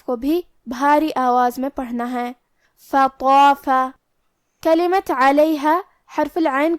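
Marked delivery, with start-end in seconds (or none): 2.9-3.7 فطاف with a full heavy ط and long ا. Shown as a demonstration. Teacher showing the heavy ta in فطاف. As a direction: round the mouth for فطاف. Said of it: "بھاری آواز میں اور منہ پھلا کے پڑھیں گے".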